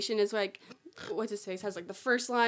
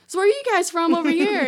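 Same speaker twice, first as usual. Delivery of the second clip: loud, high guide patter; tour guide deflecting; 7 semitones higher, 9 dB louder than usual. Retold or imitated. Fake guide dodging a tourist's question, bluffing loudly. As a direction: throw the question out loudly to the crowd.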